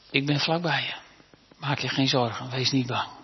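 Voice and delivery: God quietly reassuring a worried believer, tender; tender reassurance. Acted. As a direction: soothe them like a frightened child. Voicing God's comfort to the listener.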